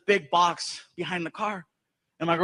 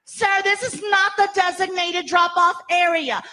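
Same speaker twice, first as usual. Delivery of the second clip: higher, slow prim official voice; stiff principal; 9 semitones higher, 4 dB louder, slower than usual. Retold or imitated. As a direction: scold the parent like a fussy principal. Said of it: "Here comes the principal".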